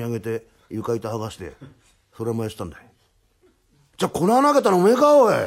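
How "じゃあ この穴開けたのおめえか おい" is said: loud, high-pitched accusing outburst; indignant accusation. Acted. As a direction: round on the culprit. 穴に落ちた泥棒の詰問. (声を張って問い詰める)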